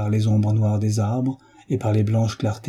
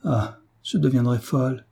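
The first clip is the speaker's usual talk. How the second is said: anguished cry; 3 semitones higher than usual. A narrator's reading. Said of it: "elle s'écria".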